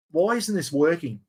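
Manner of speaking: usual speaking voice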